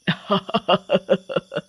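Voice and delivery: gloating, staccato witch laugh; witch cackle. Acted. Witch gloats over the bottle handed to her, cackling. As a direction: laugh in a rapid gloating run.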